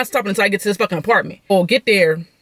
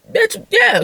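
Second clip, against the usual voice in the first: pitched way up, a mocking yes; sassy retort; 13 semitones higher than usual. Telling her ex yes, a high sassy retort. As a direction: toss it off as a sassy reply.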